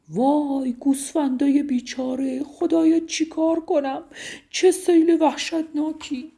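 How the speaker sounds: high, loud anguished cry; desperate shout. A narrator's reading. Shepherd Soleiman crying out as the flood takes his sheep, loud and high. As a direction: cry out to God in despair over the flood.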